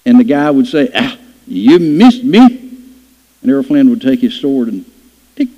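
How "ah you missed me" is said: villain's taunt. Retold or imitated. Villain taunting in the swordfight story.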